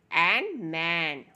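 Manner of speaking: drawn-out blending into मैन; slow phonics blend. Shown as a demonstration. Teacher blending sounds slowly for children. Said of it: "एम का sound है म".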